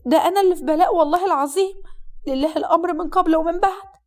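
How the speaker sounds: high, exasperated female lament; long-suffering bride. Acted.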